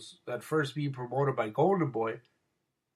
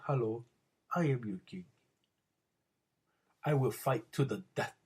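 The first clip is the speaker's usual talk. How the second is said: lower, quieter mock Wakanda king accent; 4 semitones lower, 5 dB quieter than usual. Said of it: "develops this thick-ass fucking accent".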